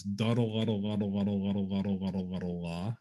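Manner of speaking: "Duddle, luddle" said as a quick tonguing chant, ending on a lifted "la"; duddle luddle articulation. Shown as a demonstration. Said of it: "the syllable that he said would, was".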